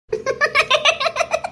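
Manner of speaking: high, soft peal of laughter; delighted giggle. Acted. Delighted laughter amid banter.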